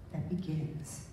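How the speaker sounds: normal talking voice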